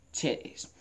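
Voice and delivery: unvoiced aside; whispered mutter. Real, not acted. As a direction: mutter it under breath. Muttered after fart.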